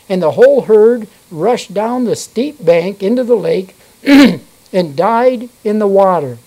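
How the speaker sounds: measured King James reading voice; steady pulpit reading; scripture reading. Acted. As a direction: read the Bible passage to the congregation. Reads scripture aloud in church.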